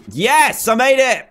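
high, loud, relieved cheer; excited high exclamation; triumphant. Real, not acted. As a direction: cheer the landing at last. Celebrates making the jump.

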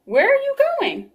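very high, loud squawk of a question; Cocky Locky voice. Acted. Cocky Locky meets the travellers, very high squawk. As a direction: crow it out high and bright.

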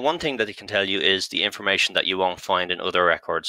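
ordinary talk

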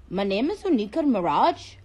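put-on Indian accent introducing herself. Shown as a demonstration. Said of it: "I can do an Indian accent".

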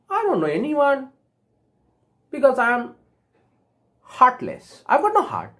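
lightly voiced storybook character, pitched high; high-pitched, halting confession of a lack; Tin Man.

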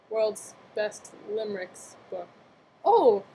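ordinary talk, nothing marked

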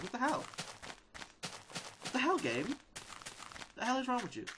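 high, incredulous what the hell, slowing at the end; exasperated outburst. Real, not acted. Yelling at the game for losing his workers, exasperated and high. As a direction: vent at the broken game.

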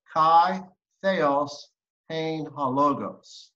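slow, careful recital of Greek phrases; deliberate scripture recitation; slow Greek reading. Shown as a demonstration. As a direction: read the Greek word by word, slowly. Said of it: "Can you read the Greek?".

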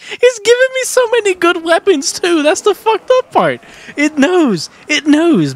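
very high, loud outburst; frantic, high-pitched gamer yelling; exasperated rant. Real, not acted. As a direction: yell in high-pitched disbelief at the game. Ranting at game's weapon box.

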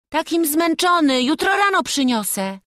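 put-on high, loud child's plea; reluctant high-pitched boy; whining boy. A narrator's reading. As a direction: whine like a tired lad. Boy whines to avoid a chore.

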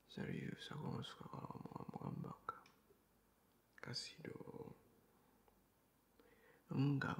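ordinary talk

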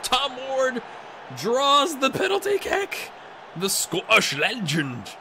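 put-on high, loud, amateur Scottish accent; attempted Scottish accent. Gamer hailing his Scottish striker, hammed-up Scottish accent. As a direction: put on a rough Scottish lilt, drawn out and high. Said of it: "about as good as the Scottish accent gets".

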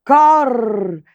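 high crow caw then a cry; crow's battle cry. A narrator's reading. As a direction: caw and charge into the fire. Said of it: "кинувся прямо на полум'я".

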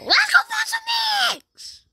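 very high, loud cheerful yell, slow; excited shout. Real, not acted. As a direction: shout it like a game show host. Hyping up after fake crying.

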